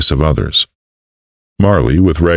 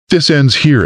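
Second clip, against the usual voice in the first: higher, firm mayor's order; stern woman; 11 semitones higher than usual. A narrator's reading. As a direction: shut the quarrel down. Marley cuts argument off.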